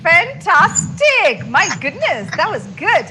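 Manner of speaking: slow, high, drawn-out cheer; delighted praise. Real, not acted. Teacher praising a student's tongue twister, stretched and delighted.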